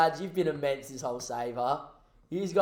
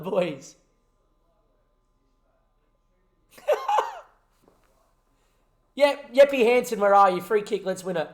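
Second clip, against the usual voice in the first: lively play-by-play, louder at moments; match caller willing the final whistle; live commentary; 6 dB louder than usual. Acted. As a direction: narrate the closing minutes like a broadcaster.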